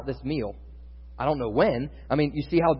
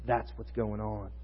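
normal talking voice

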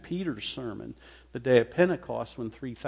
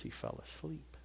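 hushed whisper; 4 semitones higher, 15 dB quieter than usual. Acted. Stephen's death, hushed.

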